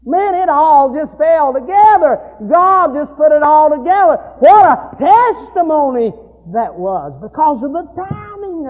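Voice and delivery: loud, high preaching cadence; preaching at full force. Acted. Preacher retelling the story to his congregation, loud and fervent.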